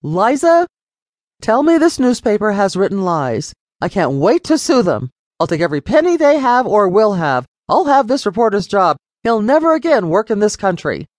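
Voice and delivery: furious, threatening to sue; father raging at his daughter over the newspaper; frustrated father. A narrator's reading. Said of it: "tone of voice gave his frustration away".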